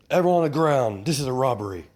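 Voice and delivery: loud and high, barking the order; robber shouting. Acted. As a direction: bellow it at the whole bank. Robber announces holdup.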